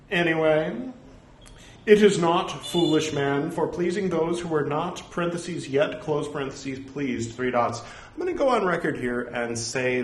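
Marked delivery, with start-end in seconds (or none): 1.8-7.8 firm, emphatic opening, evenly read out; ceremonious reading with punctuation spoken; formal scripture recitation. Acted. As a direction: declaim the rebuke as written text. Reads scripture aloud.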